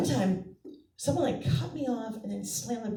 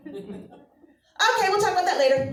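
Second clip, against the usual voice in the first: dad voice; 8 semitones higher, 6 dB louder than usual. Retold or imitated. Dad again, through laughter.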